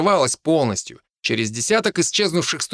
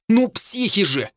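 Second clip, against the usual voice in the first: high-pitched exasperated rant; indignant high tirade; angry bark; 9 semitones higher than usual. A narrator's reading. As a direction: rail at companions, pitched well up. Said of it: "рявкнул Хинниган".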